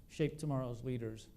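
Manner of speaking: ordinary talk, nothing marked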